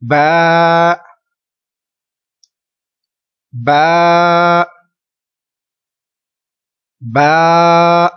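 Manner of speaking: बा held long, not बे; Arabic ba. Shown as a demonstration. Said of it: "repeat कीजिए तीन मरतबा".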